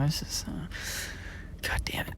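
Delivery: whispered curse under the breath; frustrated whisper. Real, not acted. Annoyed at what the classmate said, a whispered curse. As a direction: mutter it unvoiced, exasperated. Frustrated reaction while reading.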